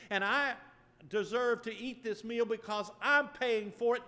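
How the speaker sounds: a hint of a proud man scolding a praying stranger; indignant rant. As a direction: huff it out like an offended diner. Rude diner's indignant rant.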